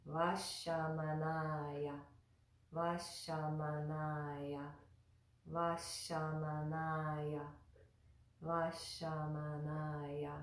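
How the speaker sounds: "Vashamanaya" slowly, second-to-last a held longer. Shown as a demonstration. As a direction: stretch the "na" in "Vashamanaya". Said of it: "we hold that a little longer".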